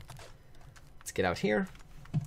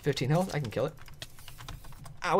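ordinary talk, nothing marked

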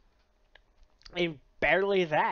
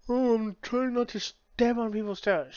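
mocking whine; 6 semitones higher, 3 dB louder than usual. Mocking other reviewers.

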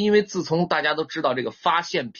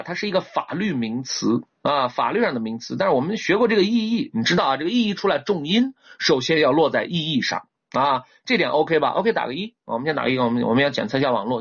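ordinary talk, nothing marked

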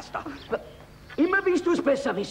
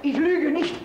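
pleading; 6 semitones higher than usual. Acted. Peasant begs for mercy.

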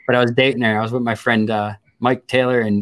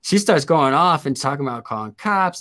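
ordinary talk

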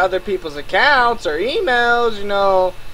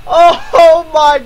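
shrieking high and loud; screaming in disbelief; 11 semitones higher, 6 dB louder than usual. Real, not acted. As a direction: scream at the stupidity. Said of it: "I'm screaming so loud".

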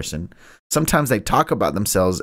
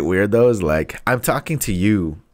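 ordinary delivery

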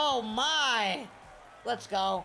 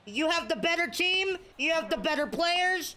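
higher, worked-up complaint; exasperated rant; 7 semitones higher than usual. Real, not acted. Gamer ranting at the opponent to throw strikes, worked up and higher. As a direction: vent at the other player, pitch climbing.

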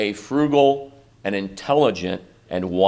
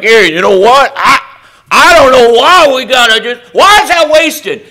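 higher, louder whiny griping disciple voice; mock complaining disciple; 11 semitones higher, 8 dB louder than usual. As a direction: play the jerk griping about waste.

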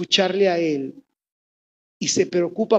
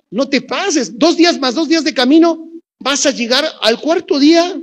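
scolding messenger; 6 semitones higher, 6 dB louder than usual. Retold or imitated. Messenger scolds Jesus, comic retelling.

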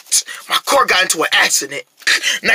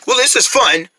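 ordinary talk, nothing marked

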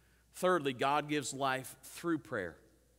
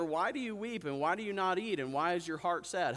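higher-pitched mock questioning; imitated clueless husband; 5 semitones higher than usual. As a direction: play the husband who has no clue, pitch lifted. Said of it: "And he says to her".

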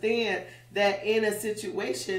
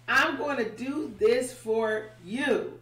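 slow, deliberate, every word weighted; slow emphatic promise; 3 semitones higher, slower than usual. Retold or imitated. God assures Moses he'll do it, slow and firm. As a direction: lean into it slowly like a vow.

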